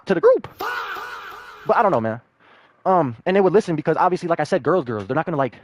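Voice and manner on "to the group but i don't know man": exasperated outburst. Real, not acted. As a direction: throw up your hands in disbelief. Ranting about Kirby.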